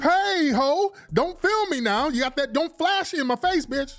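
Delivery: very high, drawn-out sung cussing calling security. Retold or imitated. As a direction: stretch the notes, then spit the curses. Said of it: "Are you cursing people out mid-song?".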